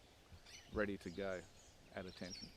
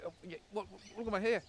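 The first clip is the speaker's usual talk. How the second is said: dismayed recruit; 9 semitones higher, faster than usual. Retold or imitated. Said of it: "The guy goes".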